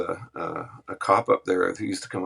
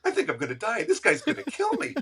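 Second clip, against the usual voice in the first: imitating panicked Tony; 12 semitones higher than usual. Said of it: "starting to freak out".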